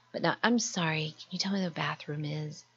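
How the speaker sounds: whispered, apologetic question about the bathroom; whispered polite question. Retold or imitated. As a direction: whisper it so as not to interrupt. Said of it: "Then you can say".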